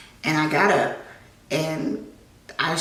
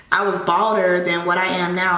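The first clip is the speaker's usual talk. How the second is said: ordinary talk, nothing marked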